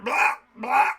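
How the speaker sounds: loud, high mock gagging; mock choking. Drinker surprised by bubbles up the straw, mock choking. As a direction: gag explosively on something sucked up.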